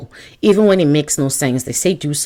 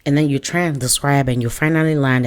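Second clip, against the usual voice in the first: ordinary talk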